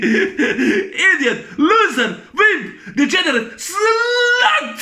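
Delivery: high-pitched insults through laughter; mock insults. Re-enacting Jim Carrey insulting everyone, gleeful mock insults. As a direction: fire off each insult, building to a stretched high cry.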